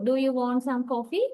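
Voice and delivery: "coffee" rising at the end; rising question intonation. Shown as a demonstration. Teacher showing a yes-no question expecting an answer, "coffee" rising. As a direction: ask for a reply, pitch climbing on "coffee". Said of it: "yes, no question has long rising intonation".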